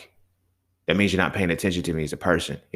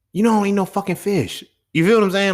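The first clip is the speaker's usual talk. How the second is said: high, loud angry complaint; angry brother; 12 semitones higher, 5 dB louder than usual. Retold or imitated. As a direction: yell at him for the fish. Brother voiced, angry at fish.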